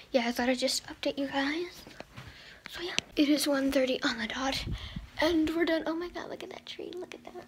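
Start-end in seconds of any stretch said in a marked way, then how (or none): 0.1-4.6 soft unvoiced whisper to the camera; hushed vlog whisper. Real, not acted. Quiet update filmed in public. 5.9-7.3 soft unvoiced whisper to the camera; hushed vlog whisper. Real, not acted. Whispering while out shopping.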